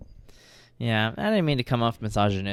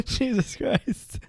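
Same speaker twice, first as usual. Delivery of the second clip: laughing; 13 semitones higher, much slower than usual. Real, not acted. Shocked by the joke.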